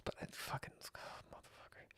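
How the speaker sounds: under-breath whispered swearing; whispered aside cursing the dogs; whispered curse. Real, not acted. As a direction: hiss the curse to yourself.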